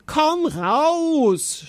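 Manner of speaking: high, drawn-out knight's cry; acted wail of rage and despair; trembling scream. A narrator's reading. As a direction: shout desperately with a quivering voice. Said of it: "schrie er mit bebender Stimme".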